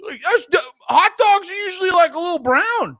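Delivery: high, worked-up comic disbelief; incredulous rant. Acted. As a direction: rant it high, baffled by it all. Ranting about the bright red hotdogs.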